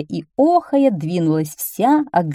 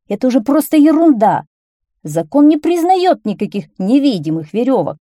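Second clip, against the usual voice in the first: raised, louder exasperated voice; irritated; 6 semitones higher, 6 dB louder than usual. A narrator's reading. Policeman dismissing the whiners' complaint, irritated. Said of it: "раздражённо сказал полицейский".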